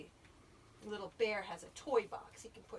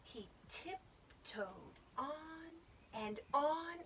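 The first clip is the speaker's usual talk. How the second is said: slow, hushed, higher storytelling; suspenseful tiptoeing; 6 semitones higher, much slower than usual. A narrator's reading. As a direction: creep along word by word. Creeping through the dark woods.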